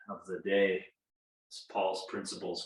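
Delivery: ordinary talk, nothing marked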